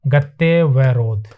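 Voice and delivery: गत्य वरोध split slowly into गत्य and वरोध. Shown as a demonstration. Teacher shows the class how गत्यावरोध is split, slowly. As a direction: draw out गत्य वरोध in slow parts. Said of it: "ये को ए पूरा कर रहा है".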